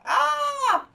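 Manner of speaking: high loud pained yelp; bird's yelp. Acted. Bird bites own leg, high loud yelp. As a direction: bite your own leg by mistake. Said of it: "says the big old bird".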